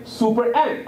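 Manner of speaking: ordinary talk, nothing marked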